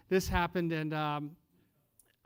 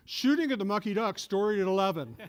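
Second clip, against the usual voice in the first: louder mock news teaser; hammed-up TV news headline; news announcer; 3 semitones higher, 7 dB louder than usual. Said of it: "the news comes on and says".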